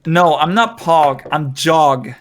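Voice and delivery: usual speaking voice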